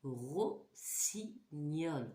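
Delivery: Rossignol drawn out slowly, syllable by syllable; teacher to class, stretching Rossignol slowly; slow syllable demo. Shown as a demonstration. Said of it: "Est-ce que j'entends mieux dans rossignol?".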